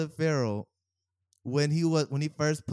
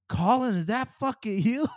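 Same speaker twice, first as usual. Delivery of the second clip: shocked; 8 semitones higher, 5 dB louder than usual. Retold or imitated. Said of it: "He come over, he was like".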